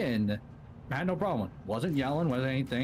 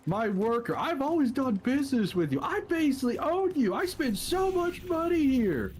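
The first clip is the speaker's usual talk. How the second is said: rising higher and louder, slowing at the end; building angry rant; 12 semitones higher, 3 dB louder than usual. Retold or imitated. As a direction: work up into an outraged rant. Irate customer ranting, building.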